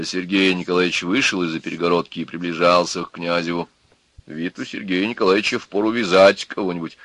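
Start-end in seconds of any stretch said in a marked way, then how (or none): none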